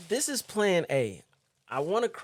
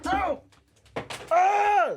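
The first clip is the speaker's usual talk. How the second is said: pained cries; much slower than usual. Real, not acted. Blinded by onion, high pained yelps to viewers. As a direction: cry out from the burning sting. Eyes burning, can't see.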